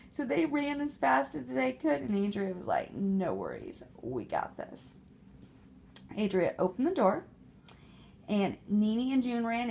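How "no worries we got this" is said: much lower, slow, unbothered; cool confident pig. Acted. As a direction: reassure them with total cool.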